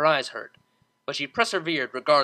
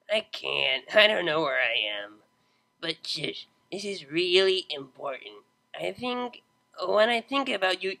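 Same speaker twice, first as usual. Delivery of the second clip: put-on drunk. A narrator's reading. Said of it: "have you been drinking".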